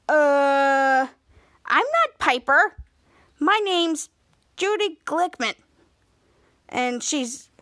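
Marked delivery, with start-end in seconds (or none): 0.1-5.5 lying. Retold or imitated. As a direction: imitate a kid fibbing about a name. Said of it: "And Piper tries to lie".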